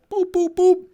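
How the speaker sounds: high, loud beep noises of dialing. Retold or imitated. Imitating phone keypad beeps while calling the precinct, high and loud.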